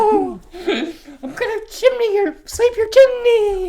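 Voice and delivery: high, loud laughing cry; laughing mock shout. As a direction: squeal it through laughter. Mock lewd ghost voice, laughing.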